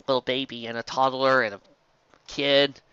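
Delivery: plain reading voice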